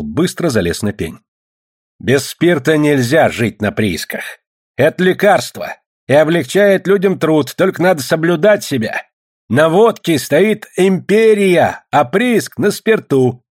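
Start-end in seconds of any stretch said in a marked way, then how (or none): 2.0-13.4 raised, deliberate speechifying voice; stump orator. A narrator's reading. Nikita argues for spirits from the stump, oratorical. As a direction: stretch key words like a village orator.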